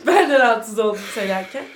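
laughing. Real, not acted. Awkward remark about offending men.

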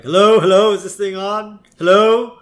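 Homer on megaphone. Retold or imitated. Homer testing the megaphone at the bridge, loud calling. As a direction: call out as into a megaphone. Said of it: "so Homer starts like".

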